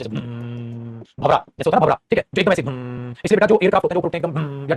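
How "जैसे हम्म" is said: a long, soft, low hum like a buzzing bee; low drone hum. Shown as a demonstration. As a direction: hum it low and steady, much softer, like a bumblebee. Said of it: "a low continuous sound".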